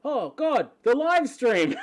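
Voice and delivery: realising you've forgotten the stream; startled cry of dismay; sudden panic. Retold or imitated. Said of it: "I'm like".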